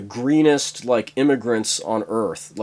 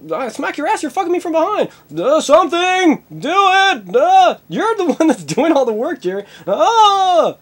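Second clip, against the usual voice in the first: hammed-up sex cries; 4 dB louder than usual. Jerry cries out to Elaine during sex, hammed-up. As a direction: squeal it out in mock ecstasy. Imagined Seinfeld sex scene.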